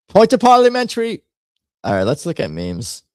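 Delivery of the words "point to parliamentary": much higher and louder pompous British voice; very formal British accent. Acted. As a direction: raise a point of order like a puffed-up MP. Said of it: "I wanted to be very formal".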